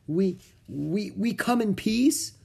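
high, hesitant stammer. A narrator's reading. Jack nervously answers the monk who called him invader, stammering. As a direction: stumble on the first word, ending unsure. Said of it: "stammered Jack".

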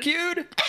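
"Piqued" as "pih-KYOOD", high and loud in disbelief; mocking mispronunciation. Co-host mocking the host's error, incredulous high "Piqued" as pih-KYOOD. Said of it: "pronouncing it piqued in my head".

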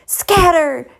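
high, urgent shout; shouted warning. A narrator's reading. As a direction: shout for everyone to flee. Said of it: "Darius shouted".

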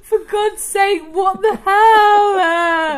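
loud, slow, exasperated disbelief; drawn-out outraged what the hell. Real, not acted. Reacting to the gong clip.

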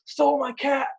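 high-pitched mock rage about a stolen cat; mock angry yell. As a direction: play the furious old neighbour accusing him. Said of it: "starts yelling".

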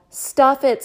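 loud, high snarl. Acted. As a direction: snap it at your brother. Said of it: "snarled Elliot".

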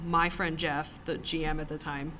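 ordinary talk, nothing marked

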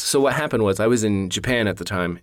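ordinary talk

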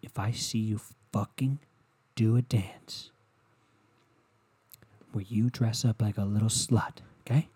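quiet, menacing mock threat; a hint of a seething partner. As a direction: threaten under your breath. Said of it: "you go".

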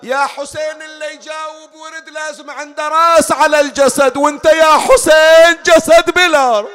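high lament building to a shouted climax; anguished wail. Acted. Preacher wails to headless Hussein.

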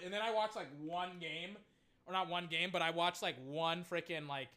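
normal talking voice

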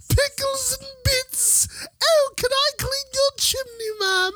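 very high falsetto British caricature; mock British accent. Hammed-up British parody.

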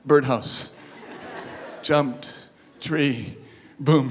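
soft, slow, word by word; quiet deadpan list of words; simply said. Retold or imitated. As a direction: lay it out one flat word at a time. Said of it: "I simply said".

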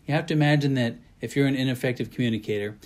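ordinary talk

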